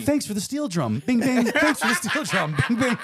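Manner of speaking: high, silly streamer voice with laughs; high mock NPC voice. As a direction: play an NPC streamer thanking tips. Mock steel drum tip thanks.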